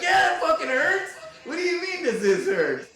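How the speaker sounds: high loud angry yell; pained yell. Retold or imitated. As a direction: yell it in pain. Yelling in pain at doctor.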